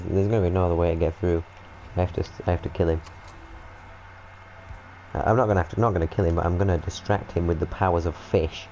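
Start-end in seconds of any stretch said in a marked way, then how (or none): none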